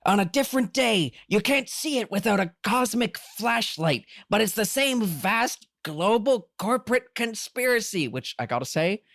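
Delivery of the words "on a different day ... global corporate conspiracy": high-pitched theatrical tirade; Dr. Amp. Acted. Podcaster acting out a conspiracy rant for the co-host, hammed-up. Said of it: "go a little theater kid on this".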